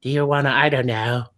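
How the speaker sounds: leer like a lecherous bird; lightly voiced pervy bird puppet; creepy bird. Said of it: "Poe's just like".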